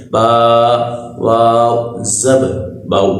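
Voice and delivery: slow syllable-by-syllable spelling drill; teacher spelling out باؤ for children. Shown as a demonstration. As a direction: spell it out letter by letter, then blend. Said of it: "ہجے اس طرح کریں گے".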